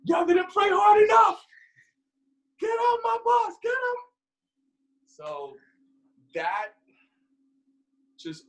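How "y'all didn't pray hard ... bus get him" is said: high, loud mock sergeant yell; mock shout, pitched way up; hammed-up drill yell. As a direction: yell it like a sergeant kicking them off the bus. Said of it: "He goes like".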